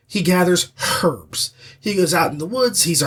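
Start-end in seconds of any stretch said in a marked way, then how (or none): 1.0-1.5 "herbs" with the H fully sounded; hard H. Retold or imitated. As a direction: hit the H at the front of "herbs". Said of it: "That's a hard H".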